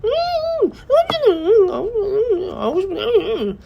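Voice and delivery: put-on very high, thin whining child; imitating a son's high mosquito-like whine; whiny mosquito voice. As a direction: whimper like a mosquito buzzing, whining and crying. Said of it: "그 모기 같은 소리로 우리 아들이 막".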